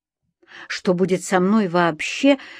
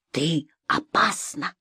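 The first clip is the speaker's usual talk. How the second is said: slow, lowered, soft; ominous verdict; 3 semitones lower, 6 dB quieter, slower than usual. A narrator's reading. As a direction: drop the voice for a slow warning. Husband's verdict on his wife. (тихо и медленно, с угрозой)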